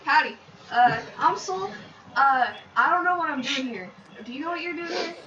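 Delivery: lightly put-on country West Virginian twang; loosely done West Virginia accent. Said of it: "that was a terrible accent".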